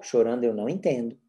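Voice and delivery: low, even set phrase; calm, neutral refusal; unchanging intonation. Shown as a demonstration. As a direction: keep it unemotional, no scolding. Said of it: "sem mudar a entonação de voz".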